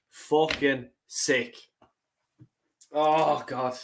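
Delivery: drawn out slowly, gutted; slow, bitter, real disgust. Real, not acted. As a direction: drag each word out in disgust. Fan gutted after loss.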